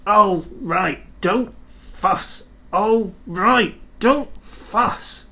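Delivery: sulky trucks complain; grumbling trucks. Acted. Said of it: "grumbled the trucks".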